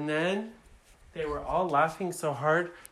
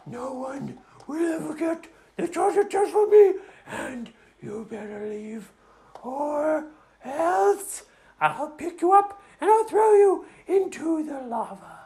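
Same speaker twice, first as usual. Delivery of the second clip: put-on menacing girl dragon; 10 semitones higher than usual. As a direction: warn them off in a high girly voice. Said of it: "And the dragon said".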